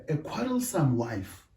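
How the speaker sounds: ordinary talk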